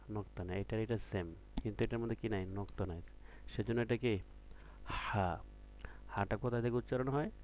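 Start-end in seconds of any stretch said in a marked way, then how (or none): 4.9-5.4 "হা" with the h made deep inside the throat. Shown as a demonstration. Teacher showing where the letter comes from, "হা" from the throat. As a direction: push the h of "হা" out from the throat. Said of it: "গলার ভিতর থেকে".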